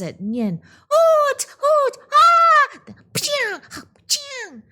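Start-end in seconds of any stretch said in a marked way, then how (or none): 0.9-2.9 very high, loud hoots; hooting shouts. Acted. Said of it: "They hooted". 3.1-4.6 firecracker sound. Acted. Firecrackers thrown.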